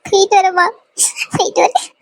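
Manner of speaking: high voice breaking into breath; crying goodbye. Acted. Bride leaving her mother's house, crying. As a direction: sob out a farewell.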